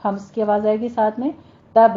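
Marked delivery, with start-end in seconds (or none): 1.7-1.9 تب with a short bounce off the ب; تب ending in a voiced rebound; qalqalah on با. Shown as a demonstration. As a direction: release the ب of تب in a small echo. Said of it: "تب میں قلقلہ کرنا ہے".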